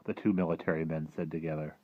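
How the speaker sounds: ordinary delivery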